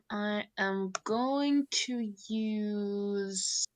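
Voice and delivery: slow, suspenseful choosing; drawn-out deliberation. Real, not acted. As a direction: stretch each word while deciding. Drawing out choice of grenade.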